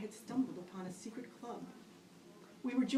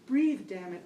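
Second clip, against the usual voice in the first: urging myself; 9 dB louder than usual. Retold or imitated. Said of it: "I kept telling myself".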